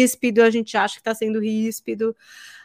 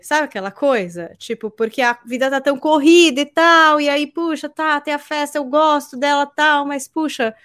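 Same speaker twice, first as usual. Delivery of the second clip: ordinary delivery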